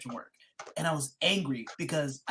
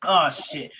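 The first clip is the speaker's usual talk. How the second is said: ordinary talk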